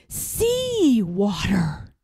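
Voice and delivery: slow, dawning dread; stunned, drawn-out realization; horrified. A narrator's reading. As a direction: let the horror sink in. Boy realizes sea flooding.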